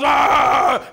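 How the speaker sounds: loud mock Hulk roar, shouted; Hulk growl. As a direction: roar it out like a kid playing Hulk. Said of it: "And he growls".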